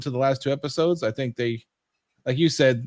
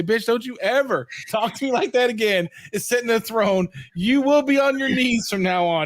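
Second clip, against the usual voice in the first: mock Emperor scolding; 8 semitones higher than usual.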